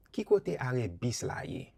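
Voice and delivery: normal talking voice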